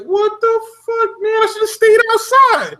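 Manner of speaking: high frantic complaint about the dungeon; panicked squeal. Retold or imitated.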